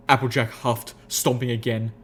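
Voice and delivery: usual speaking voice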